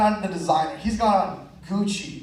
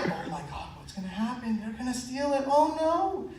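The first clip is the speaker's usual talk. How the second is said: hushed suspense; 4 semitones higher, 7 dB quieter than usual. Retold or imitated. Hushed tension watching game show.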